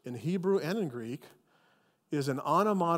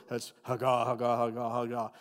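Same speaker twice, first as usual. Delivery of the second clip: haga, haga repeated low like distant muttering; distant grumbling haga; 5 semitones lower than usual. Shown as a demonstration. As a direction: rumble haga, haga under your breath. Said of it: "what it sounds like when you hear from a distance somebody grumbling".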